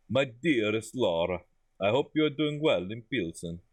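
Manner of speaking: put-on letter read in a makeshift Eastern European accent; put-on foreign accent. Boss writing to Laura in Pilsen, put-on foreign accent. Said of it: "I've just given this accent".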